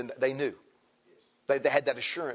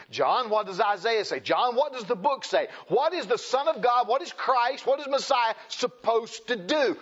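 pressing questions; 7 semitones higher than usual. Acted. Jesus pressing John with questions.

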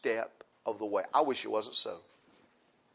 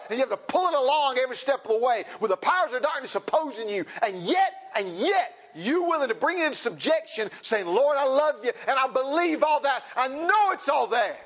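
high, loud preacher's cry; preaching at full force; 10 semitones higher, 4 dB louder than usual. Acted. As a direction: preach it hard to the congregation.

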